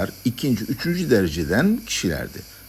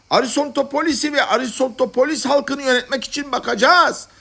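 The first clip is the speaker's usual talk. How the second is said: very high-pitched haranguing voice; rally speech. Acted. Madman to his fellow madmen, haranguing them. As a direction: rouse the crowd like a fervent orator.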